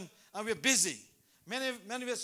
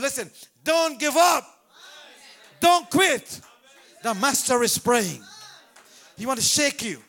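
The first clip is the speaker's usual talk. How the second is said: preaching at full force; 2 semitones higher, 12 dB louder, slower than usual. Acted. Preacher urging the congregation, full-force preaching. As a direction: preach it loud and hard.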